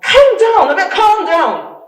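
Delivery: imitating sister yelling, high and very loud; shouted back. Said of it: "she just shouted back".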